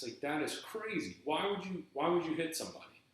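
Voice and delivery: retold exasperation, voice climbing high; indignant protest. Employee scolding the manager for hitting him, indignant.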